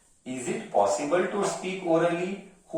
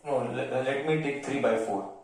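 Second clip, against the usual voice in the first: ordinary talk, nothing marked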